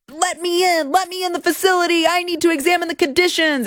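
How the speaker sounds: high, fast whiny demand to be let in; mocking congresswoman. Host mocks McIver demanding entry, high and whiny. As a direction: play her as a shrill entitled lawmaker. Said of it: "LaMonica McIver was like".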